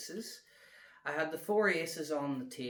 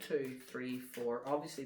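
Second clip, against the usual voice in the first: normal talking voice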